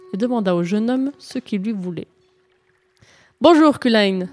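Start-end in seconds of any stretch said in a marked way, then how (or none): 3.4-4.3 a louder, lifted greeting; calling out. Acted. Knight greeting the smith at his forge, calling out. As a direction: hail him across the yard. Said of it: "s'écria le chevalier".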